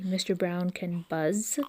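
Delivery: plain reading voice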